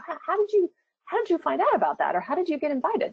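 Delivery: high-pitched, amazed coworker questions; acted curious surprise. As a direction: ask eagerly, voice lifted well up.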